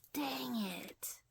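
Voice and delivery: soft unvoiced whisper to herself; whispered dang it. Real, not acted. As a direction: breathe it out under her breath in dismay. Doll hair falling out.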